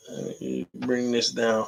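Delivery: slow, very soft voice; quiet. Real, not acted. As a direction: bring your voice right down. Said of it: "Stop shouting, T D".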